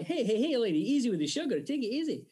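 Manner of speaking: high, lively Scottish lilt; Scottish accent. Acted. Quoting the film he learned from, Scottish accent. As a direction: mimic a Scot from Gregory's Girl. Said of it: "speak my Scottish accent from watching Gregory's Girl".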